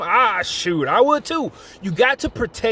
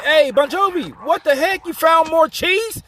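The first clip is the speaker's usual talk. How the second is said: high-pitched incredulous question; high, drawn-out disbelief at the end; acted astonishment; 8 semitones higher than usual. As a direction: call out in mock amazement at a friend's find. Acted excited [name] confronting [name].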